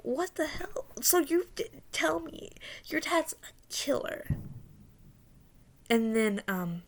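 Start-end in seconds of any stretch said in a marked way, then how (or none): 0.0-4.3 high-pitched, disbelieving questioning; retold incredulity. Dreamer learning his dad's a killer, incredulous. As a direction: act out stunned disbelief, pitched way up.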